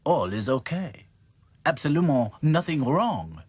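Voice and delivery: lightly voiced French doll; a hint of a French accent. Claude reassures the dolls in French accent. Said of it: "said Claude".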